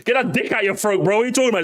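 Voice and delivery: ordinary delivery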